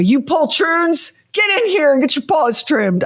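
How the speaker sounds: high-pitched mock outrage at the cats; mock scolding. Cat owner summoning runaway cats for claw clipping, mock scolding. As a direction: call them to order with playful indignation.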